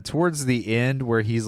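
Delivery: ordinary talk, nothing marked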